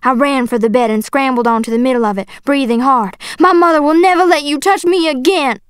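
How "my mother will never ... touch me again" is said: higher, impassioned shout; defiant shout. A narrator's reading. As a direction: cry it out in protest. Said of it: "I shouted".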